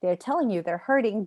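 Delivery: ordinary talk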